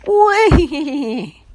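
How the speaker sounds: high neighing whinny like a real horse. A narrator's reading. Boy nudged by his friend, realistic horse whinny. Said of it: "Joey whinnied".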